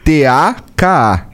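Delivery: slow, deliberate letter-by-letter spelling. Shown as a demonstration. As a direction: spell each letter slowly. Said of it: "vou soletrar de novo".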